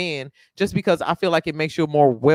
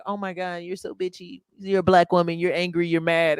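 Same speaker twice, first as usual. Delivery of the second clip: lightly voiced judgmental critic, softer; mock accuser; 4 dB quieter than usual. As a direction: imitate someone name-calling in an undertone. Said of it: "stop being like".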